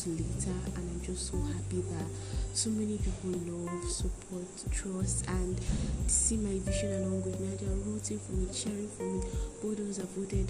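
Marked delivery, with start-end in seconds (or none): none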